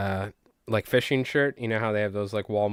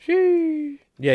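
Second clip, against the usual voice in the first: a sudden high squeak of a yeah; a short squeaky yeah; 4 dB louder than usual. Real, not acted.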